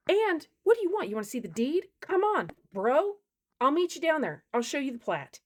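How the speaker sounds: high, loud, daring him to argue; fired-up challenge. Real, not acted. Angry homeowner daring the trespasser to check the deed. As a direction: square up and call him out.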